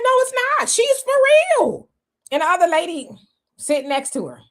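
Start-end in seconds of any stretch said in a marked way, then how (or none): 0.0-1.9 high, slow, louder no it's not; stunned realization. Retold or imitated. Host retelling realizing she meant it, stunned. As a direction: drag it out in shocked disbelief. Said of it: "And I'm like".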